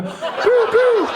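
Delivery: very high, loud pew pew blaster sounds; gun noises. Pretend. Comedian defending the fort from baddies, squeaky pew pew blasts. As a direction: fire a toy laser gun with your mouth. Shooting noises in pretend game.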